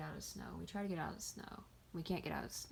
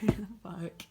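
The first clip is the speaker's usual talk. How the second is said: louder mock yell as dad; imitated yell; 3 semitones higher, 6 dB louder, slower than usual. Dad yelling at the panicking speaker, imitated angry yell. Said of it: "like, yelling at me".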